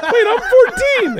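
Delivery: very high, drawn-out mock shout; shocked kid. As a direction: shriek it like a kid doing the math, laughing. Voicing the kid doing the math.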